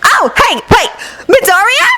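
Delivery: put-on high girl calling brightly; bubbly girl calling out. Mina calling out.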